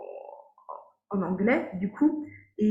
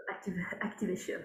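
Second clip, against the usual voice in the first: laughing whisper; 10 dB quieter than usual. Real, not acted. Repeats word laughing softly.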